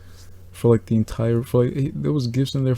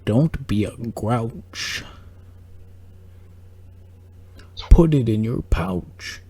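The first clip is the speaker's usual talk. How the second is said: slow goofy mascot voice; much slower than usual. Pretend. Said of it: "he turns to the camera and he goes".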